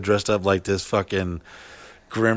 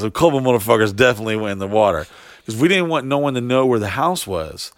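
usual speaking voice

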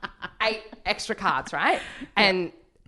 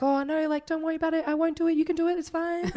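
high-pitched, light, placating protest; flustered reassurance; 9 semitones higher than usual. Retold or imitated. Teller replays herself reassuring the magician she won't touch it, flustered. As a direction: wave it away in a high, small voice. Her flustered polite refusal, retold.

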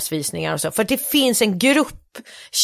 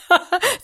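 high, polite forced chuckle; a dutiful highbrow titter; intellectual laugh; 9 semitones higher than usual. Retold or imitated. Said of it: "så här intellektuella skratt". (högt, artigt intellektuellt skratt)

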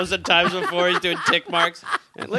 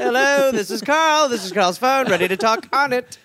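higher, put-on phone greeting; mock phone answering; 6 semitones higher than usual. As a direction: parody an over-eager call answerer. Mocking Carl's phone greeting.

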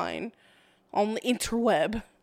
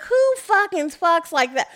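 ordinary delivery

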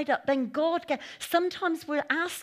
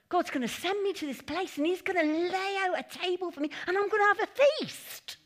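eager hope; 7 semitones higher, 3 dB louder than usual. Retold or imitated. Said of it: "that's how we think".

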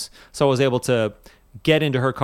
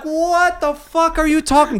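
much higher, slowed, incredulous; this makes no sense; retold baffled outburst; outraged disbelief; 4 dB louder, slower than usual. Outraged disbelief at keys claim.

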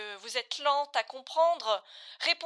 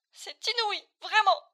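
much higher and louder, fed up; exasperated outburst; 7 semitones higher, 5 dB louder than usual. Acted. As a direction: throw up your hands in loud exasperation. Exasperated examiner loses patience. (s'emporte, exaspéré, à bout de patience)

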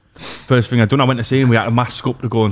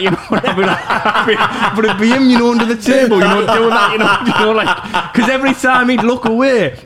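laughing while telling; 9 semitones higher than usual. Real, not acted. Telling a story he can't stop laughing at.

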